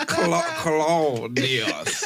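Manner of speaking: slow, drawn-out name through laughter; laughing. Real, not acted. Host laughing at the crawfish's name. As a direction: savour the name while cracking up.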